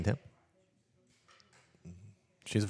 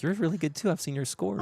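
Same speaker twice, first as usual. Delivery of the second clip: put-on young girl voice; 13 semitones higher than usual. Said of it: "And Lily's like".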